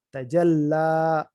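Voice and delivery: تجلا with the لّ doubled and the final ا long and drawn out; تجلا: lean on the لّ, then stretch the final ا; stressed doubled lam. Shown as a demonstration. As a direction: say تجلا slowly, holding the doubled لّ. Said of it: "کھڑا زبر شد اور کھڑا زبر".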